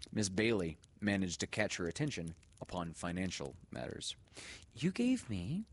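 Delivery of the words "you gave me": high, bright-toned teacher voice; teacher addressing mother. A narrator's reading. Teacher telling the mother about the money, high and polite. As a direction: speak politely to his mother.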